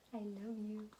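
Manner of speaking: tender hush. Real, not acted. Mom whispers to baby.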